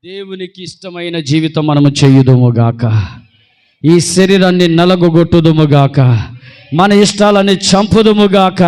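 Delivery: loud, slow solemn declaration; preaching at full force. Acted. Preacher leading the church in vows, loud and slow. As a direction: pronounce each vow slowly, like a blessing.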